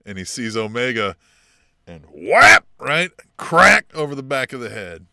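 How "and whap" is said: a loud imitated smack on whap. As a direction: slam the word whap out like a hit. Retelling broom hit to head.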